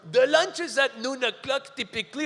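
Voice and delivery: lightly mock a French speaker's clumsy English; Franco-Ontarian English. Boss explains lunch in stilted French-accented English. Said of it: "He goes".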